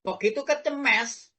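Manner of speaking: ordinary talk, nothing marked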